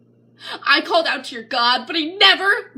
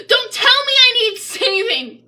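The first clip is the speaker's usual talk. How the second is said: high, loud defiant cry; a loud refusal of pity; defiant outburst; 9 semitones higher, 4 dB louder than usual. Acted. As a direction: throw it back at them in defiance. Defiant retort to preachers.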